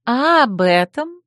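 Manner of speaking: hint at a secret with a smile; mysterious. Acted. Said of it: "таинственно улыбнулась Хеки". (таинственно)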